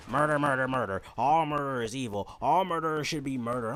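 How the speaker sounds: hammed-up lecture from a fed-up teacher; ranting teacher. Student mocking the fifth grade teacher's murder rant. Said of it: "twenty minute rants about how she hated murder".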